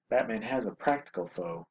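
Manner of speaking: usual speaking voice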